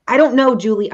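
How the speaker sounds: frustrated outburst. Retold or imitated. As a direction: snap "I don't know, Julie" in exasperation. Husband's frustrated reply retold.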